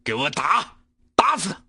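building to a loud command, word by word; staccato. Acted. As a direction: bark the order syllable by syllable. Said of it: "一字一顿地说出了最后".

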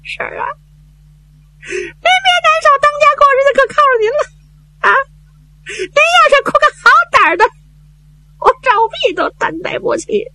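high, tearful voice, sobs on the first words; crying while consoling. Acted. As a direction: console the aunt while weeping. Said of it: "他一边哭". (赵弼一边哭一边高声劝婶子)

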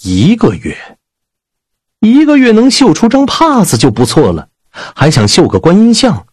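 high, incredulous protest; indignant disbelief. Acted. Protesting the consort's one-month deadline, incredulous. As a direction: echo the deadline, then leap up in pitch to object.

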